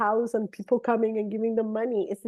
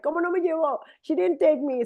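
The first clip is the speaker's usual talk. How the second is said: playful complaining; 6 semitones higher than usual. Retold or imitated. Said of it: "complaining in a, in a good way".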